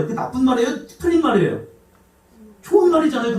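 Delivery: ordinary talk, nothing marked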